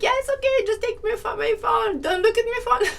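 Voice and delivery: high-pitched mock-reluctant handover, laughing at the end; joking. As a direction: jokingly give up the phone in a high voice. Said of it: "No, I was joking".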